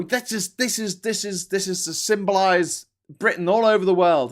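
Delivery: mock proclamation. Imagined proclamation by foreign builders.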